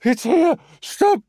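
high, loud panicked yelling; terrified shout. A narrator's reading. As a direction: shriek a warning in terror. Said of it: "wide with fear".

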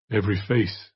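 a touch of hardness, lightly slowed; slow, hard voice. A narrator's reading. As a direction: say it firm and unyielding, understated. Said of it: "in a slow, hard voice".

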